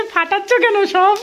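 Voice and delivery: put-on high, slow little child voice; child's voice. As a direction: quote the little one, high and drawn out. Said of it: "বলছে".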